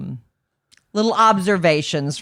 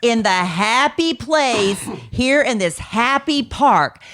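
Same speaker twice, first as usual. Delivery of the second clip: mock frustrated parent; 6 semitones higher, slower than usual. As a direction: ham up a stressed dad. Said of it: "That's basically what he wanted to say".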